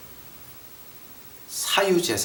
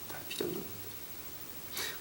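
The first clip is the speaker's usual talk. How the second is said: slow whispered "다 필요 없는 것들"; 25 dB quieter, slower than usual. Real, not acted. Preacher whispering that possessions are useless. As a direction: breathe the words out.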